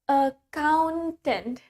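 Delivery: very slow, drawn-out "Accountant"; "Accountant" said slowly and carefully; slow model pronunciation. Shown as a demonstration. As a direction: stretch "Accountant" out for learners to follow. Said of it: "Let's pronounce it with me".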